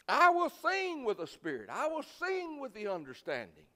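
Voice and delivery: loud, high declaration of the verse; preached scripture. Acted. Pastor quoting Corinthians to the congregation: loud preached declaration. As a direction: proclaim the verse like a rallying cry.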